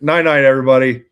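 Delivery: loud sing-song sign-off; loud goodbye. Real, not acted. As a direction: call out good night loudly.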